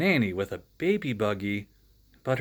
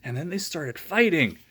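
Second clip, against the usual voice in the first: plain reading voice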